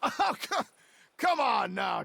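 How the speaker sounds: high, loud scoffing laugh; incredulous chuckle breaking into loud protest. Acted. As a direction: laugh off the accusation. Accused man laughs off charge.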